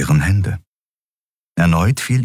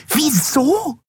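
„Wieso?“ echoed much higher in a shrill squeal; shrill repeat. A narrator's reading. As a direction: throw the word back shrilly. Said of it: "wiederholte die Yadi schrill". („Wieso?“ schrill und viel höher nachgeäfft)

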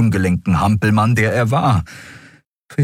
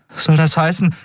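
put-on high, hopeful boy voice; young lad barely daring to hope; excited boy; 11 semitones higher than usual. A narrator's reading. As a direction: ask it high with dawning excitement. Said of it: "Miko zitterte vor Aufregung".

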